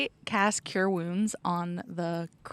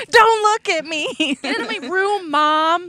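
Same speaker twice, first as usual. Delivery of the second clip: high, loud sulky shout; bratty teen yell; 11 semitones higher, 9 dB louder than usual. Pretend. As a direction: yell at mom from your room.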